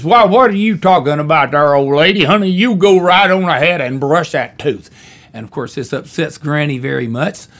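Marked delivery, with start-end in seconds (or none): 0.0-4.9 put-on louder good-old-boy husband voice. Husband barges in and backs his wife, louder. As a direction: boom it like a country husband. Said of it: "her husband comes in and says".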